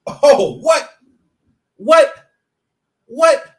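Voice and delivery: high, very loud outburst; loud exclamation. Acted. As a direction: punch each word out as a separate shout. Sudden outburst of repeated exclamations.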